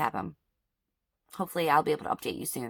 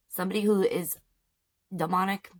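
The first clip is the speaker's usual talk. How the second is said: ordinary delivery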